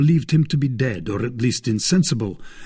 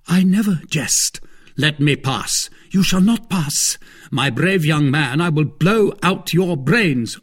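raised, higher-pitched defiance; heated challenge; 5 semitones higher than usual. A narrator's reading. D'Artagnan and gentleman dispute the passage, heated challenge. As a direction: trade threats in a mounting quarrel. Quarrel building to a threat.

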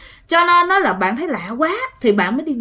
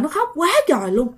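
normal talking voice

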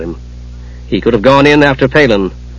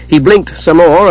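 ordinary delivery, nothing marked

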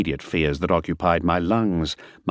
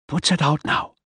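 firm character order; 9 semitones higher than usual. Acted. Miller orders.

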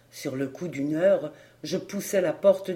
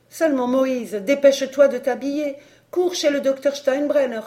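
high, loud command to hurry; urgent order; 9 semitones higher, 7 dB louder than usual. Acted. Wife sending her husband for the doctor, high and loud. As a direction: send him running.

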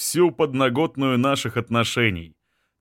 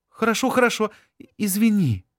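woman's voice; 9 semitones higher, faster than usual. Acted. Anzhela backs down.

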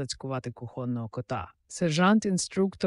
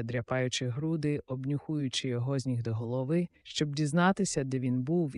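ordinary delivery, nothing marked

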